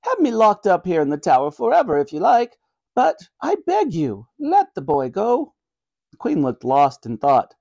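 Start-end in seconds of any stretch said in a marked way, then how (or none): 0.0-5.5 high-pitched, earnest granny voice; pleading granny. Acted. Granny begging mercy for her grandson, pleading. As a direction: beg as an old grandmother.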